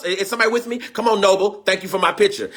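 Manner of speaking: ordinary talk, nothing marked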